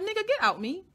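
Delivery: high-pitched retold demand to get out; retold blunt order. Telling him to get out, high-pitched retold order.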